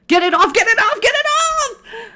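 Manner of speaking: hammed-up panic; panicked shrieking. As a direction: shriek to get it off your back. Panicking, thing on back.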